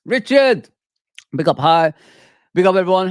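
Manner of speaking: loud, lifted shout-out; loud greeting. Real, not acted. Streamer greeting viewer Richard, loud excited shout-out.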